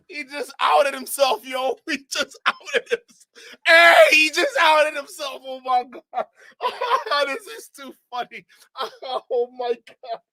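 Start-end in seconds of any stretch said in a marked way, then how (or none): 0.1-8.4 slow, laughing and much higher. Real, not acted. Streamer laughing that the man outed himself, slow and laughing. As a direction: spell it out between laughs.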